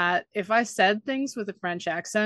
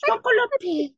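higher, laughing fake French; mock French accent; 4 dB louder than usual. Sister repeating her fake French, in mock French accent. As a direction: blurt it again in bad French. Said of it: "I just went".

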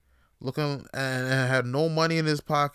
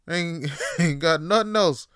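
ordinary talk, nothing marked

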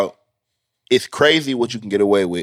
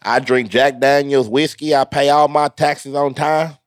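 folksy country drawl; 6 semitones higher, 3 dB louder than usual. Pretend. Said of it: "redneck voice".

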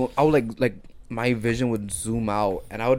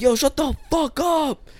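very high, drawn-out mock yell; screaming back; slower than usual. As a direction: stretch it out like a far-off screaming match. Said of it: "He would scream back".

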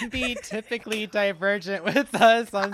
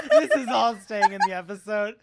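normal talking voice

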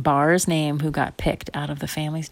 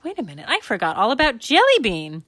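put-on higher-pitched child's alarm; sudden realization; 6 semitones higher than usual. Girl suddenly remembers her hamster, alarmed. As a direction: blurt it as a remembering kid.

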